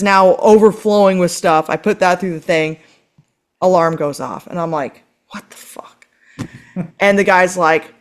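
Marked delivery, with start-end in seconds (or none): 5.3-6.5 unvoiced startled whisper; whispered curse. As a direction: breathe it under your breath in disbelief. Alarm goes off at security.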